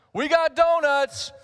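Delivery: high, loud, slow announcement; calling out. Retold or imitated. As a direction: call it out to the whole office. Clerk announces to coworkers.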